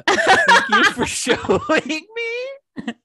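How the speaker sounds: high, slow laughing thanks. Real, not acted. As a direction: squeal through a laugh. Sarcastic reaction to tattoo.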